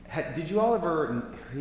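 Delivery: usual speaking voice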